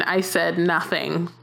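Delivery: ordinary talk, nothing marked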